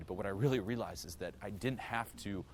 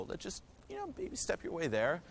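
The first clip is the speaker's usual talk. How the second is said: higher, sing-song self-coaxing voice; higher pitched, mock encouraging inner voice; 10 semitones higher than usual. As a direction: talk yourself into it like a gentle coach. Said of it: "I'm like".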